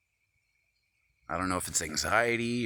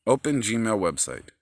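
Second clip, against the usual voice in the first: louder, impatient repeat of the command; 3 semitones higher, 8 dB louder than usual. Real, not acted. Phone ignored him, repeats it louder and irritated. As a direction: bark it again at the phone.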